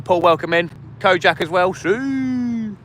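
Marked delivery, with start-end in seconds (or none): 1.7-2.8 long, high celebratory "Sue"; Siuuu celebration call. Real, not acted. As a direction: hold it high like a goal celebration. Celebrating a donation.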